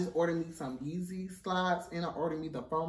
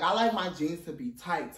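ordinary talk, nothing marked

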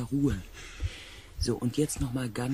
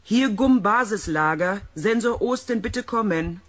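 calling over radio; 6 semitones higher, 7 dB louder than usual. Acted.

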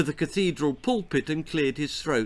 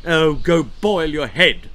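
put-on louder snappish old lady; snapping grandma; 3 semitones higher, 8 dB louder than usual. A narrator's reading. Grandma snapping at her sister, loud. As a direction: bite her head off. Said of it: "snapped Grandma Wilhelmina".

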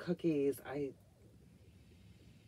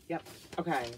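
normal talking voice

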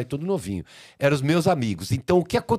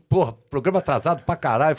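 normal talking voice

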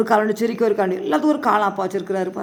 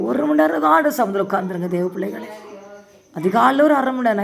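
normal talking voice

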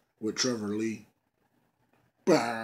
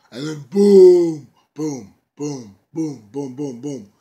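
imitated entrance beats; 3 semitones higher, 16 dB louder, slower than usual. Imitating entrance music hits.

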